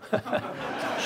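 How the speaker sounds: quick breathy laugh. Real, not acted. Preacher laughs at own story.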